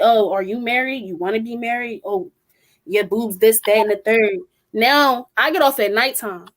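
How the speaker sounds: normal talking voice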